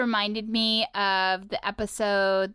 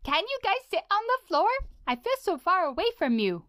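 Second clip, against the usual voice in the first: high, sweet little robot voice; a tiny robot asking friends to come closer; Bimo impression; 11 semitones higher than usual. To his co-host, in a high little robot voice. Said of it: "give me an impression of a character".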